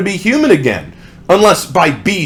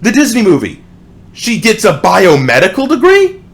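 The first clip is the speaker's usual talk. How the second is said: incredulous; 6 semitones higher, 3 dB louder than usual. Real, not acted. Disbelief at the lyric.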